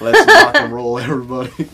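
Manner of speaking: high, laughing Southern rallying cry; rally them in a put-on Southern accent. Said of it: "get my Southern accent back".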